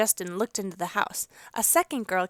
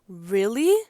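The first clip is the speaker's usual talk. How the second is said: eager; 6 dB louder than usual. A narrator's reading. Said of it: "Jade asked eagerly".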